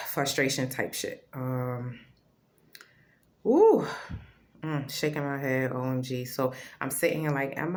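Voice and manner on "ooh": high, loud exclaimed ooh; sudden ooh. Real, not acted. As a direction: blurt it out, caught up. Exclaims over her frustration.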